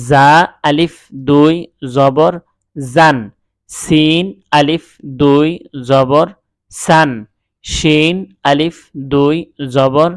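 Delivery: teacher's sing-song spelling chant, each letter set apart; spelling drill. Shown as a demonstration. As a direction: call out the letters for students to repeat.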